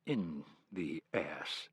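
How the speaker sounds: reluctant embarrassed admission; slightly embarrassed. Acted. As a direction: mumble it sheepishly. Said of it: "in a slightly embarrassed tone".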